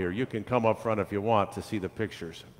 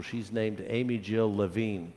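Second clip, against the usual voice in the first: plain reading voice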